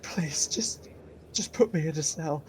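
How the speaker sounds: high, soft whimper; frightened prisoner pleading weakly; scared pleading. Acted. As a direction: beg in a near whisper, faltering. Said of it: "He's too scared".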